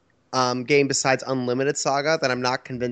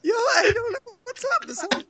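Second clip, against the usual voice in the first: laughing. Real, not acted. Giggling fit on podcast.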